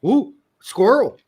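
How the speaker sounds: high, loud goofy dog voice; talking dog. Pretend. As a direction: play a happy dim dog. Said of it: "how I imagine ... dogs talking".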